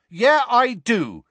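slow, high, loud angry reply; angrily. A narrator's reading. Ron answering Seamus slowly and angrily. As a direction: plant each word in anger. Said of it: "said Ron angrily".

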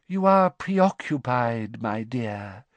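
slow, mournful mystic voice. A narrator's reading. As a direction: sigh it out like a sad prophet. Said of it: "she said mournfully to Harry".

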